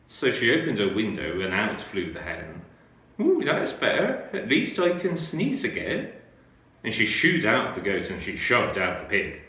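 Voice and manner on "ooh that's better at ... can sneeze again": put-on higher, brighter-pitched old lady sighing with relief; enjoy the new space in the old lady's voice; relieved old lady.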